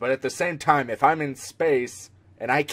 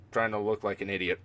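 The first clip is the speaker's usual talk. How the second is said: quiet; 8 semitones lower, 10 dB quieter, faster than usual. Real, not acted. Self-mocking aside.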